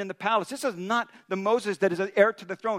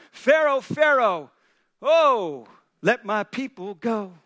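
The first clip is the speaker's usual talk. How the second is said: commanding call; 5 dB louder, slower than usual. Acted. Moses and Aaron demand of Pharaoh.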